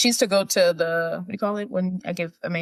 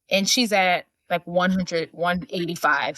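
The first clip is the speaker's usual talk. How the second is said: ordinary talk, nothing marked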